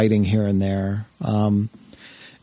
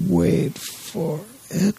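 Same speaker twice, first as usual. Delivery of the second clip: very slow, higher, teasing suspense; drawn-out stalling; 7 semitones higher, 3 dB quieter, much slower than usual. Real, not acted. Host stalling co-host while searching game list, exaggeratedly drawn out. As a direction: stretch it out with the pitch climbing.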